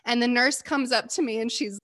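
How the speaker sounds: ordinary talk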